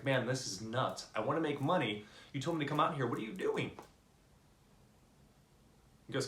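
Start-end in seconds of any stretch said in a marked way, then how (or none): 0.0-3.7 put-on fed-up kid's protest, pitch climbing; acted-out exasperated kid; exasperated complaint. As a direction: complain higher and higher to a baffled question. Kid protests, deeper in the water.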